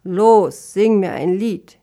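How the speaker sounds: loud, commanding order; imperious little master; curt command. Acted. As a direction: order them to perform at once.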